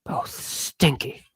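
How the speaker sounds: slow hushed whisper, dry and snide; whispered aside. Real, not acted. Confiding to the audience about the seatmates, slow whisper. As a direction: lean in and whisper the punchline slowly.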